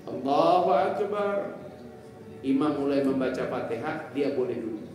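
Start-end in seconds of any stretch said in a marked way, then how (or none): 0.0-1.6 slow, drawn-out prayer call, raised and loud; stretched-out, projected takbir; demonstrated takbir. Shown as a demonstration. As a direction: intone it like an imam opening prayer. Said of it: "takbir pertamanya harus berdiri".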